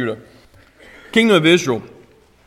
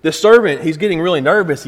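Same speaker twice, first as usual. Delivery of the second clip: ordinary delivery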